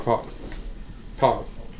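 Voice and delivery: hushed, breathy pause, pause; whisper-soft joking pause; quiet pause. Real, not acted.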